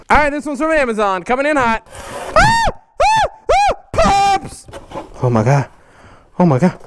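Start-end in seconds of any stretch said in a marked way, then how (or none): 2.4-4.4 sudden high scared yelps; startled scream. Real, not acted. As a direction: shriek as if jumped at. Startled by package.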